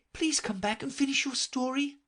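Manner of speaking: calling after. A narrator's reading. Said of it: "Alice called after it".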